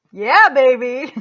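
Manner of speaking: loud, slow gleeful cheer; celebrating. Real, not acted. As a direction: savor the win out loud. Celebrating her own affirmation.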